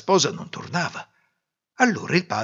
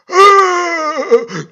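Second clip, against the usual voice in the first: loud, high drawn-out wail; hammed-up cry of grief; anguished cry; 14 dB louder than usual. Father hearing of the imagined death, crying out. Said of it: "gridò il padre".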